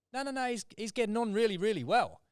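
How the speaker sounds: a mock-cheery client who contradicts the recruiter. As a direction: brush it off cheerfully, up high.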